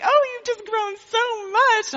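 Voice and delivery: gushing church lady. Retold or imitated. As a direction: coo like a doting old lady. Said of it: "all the people are like".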